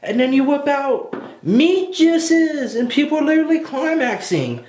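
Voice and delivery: high-pitched, scandalized incredulity; comic disbelief. Real, not acted. Podcaster complains to co-host about food anime twist, high incredulous outburst. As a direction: exclaim it way up high, stretching the final word.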